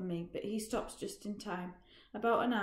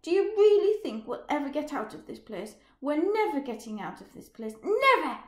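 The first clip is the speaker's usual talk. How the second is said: angry shout; 6 semitones higher, 9 dB louder than usual. Acted. Roger shouting during the fight.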